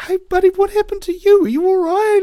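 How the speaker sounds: high, fast, loud worried concern; concerned helper. Acted. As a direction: rush over to help. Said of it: "antioxidant comes up and goes".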